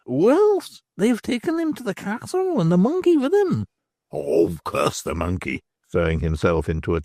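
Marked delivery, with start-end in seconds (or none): 0.0-3.6 much higher, cackling crone voice; a knowing old beldam; old hag's voice. Acted. 4.1-5.6 lower, slower man's voice; a worried man swearing under his breath; Stracey's voice. Acted. Stracey despairs.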